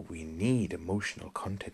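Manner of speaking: a hint of the calm master instructing; gentle Bruce Lee imitation; imitating Bruce Lee. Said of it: "how he delivers that line is fantastic".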